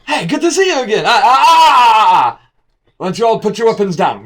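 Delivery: high, loud, giddy character voice; squeaky bright stranger calling out; excited NPC greeting. Acted. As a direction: greet them like an over-eager host.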